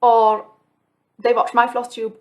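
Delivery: ordinary talk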